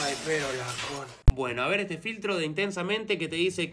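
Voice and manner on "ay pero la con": deep slowed, cut off. Acted. Video cut, voice slowing deep. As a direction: drag it down like a dying tape.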